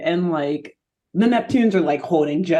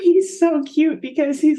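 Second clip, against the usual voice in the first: ordinary talk, nothing marked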